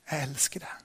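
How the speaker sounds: soft unvoiced whisper, full of love; tender whisper. God answers the sinner, a tender whisper. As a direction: breathe it gently as a loving answer. God's answer to our lives.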